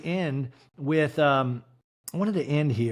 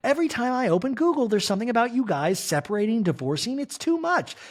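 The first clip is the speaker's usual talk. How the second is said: higher, fast, fretting mother-in-law; mock high worried mother; 9 semitones higher than usual. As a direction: rush the worry, pitched up. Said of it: "Mauricio's mother to Kyle going".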